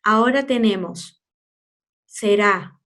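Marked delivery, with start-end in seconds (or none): none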